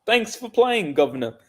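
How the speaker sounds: playful put-on British voice; British accent. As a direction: tip your hat like a cockney: thanks, guv. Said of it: "That's pretty good".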